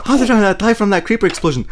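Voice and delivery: fast, high, disbelieving; amazed. Real, not acted. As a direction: rush it out in disbelief. Amazed at surviving.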